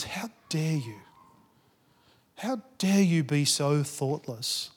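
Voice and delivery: hissed slow rebuke; a shocked pastor scolding under his breath; whispered How dare you, then slow. Acted. Pastor scolds girl in story.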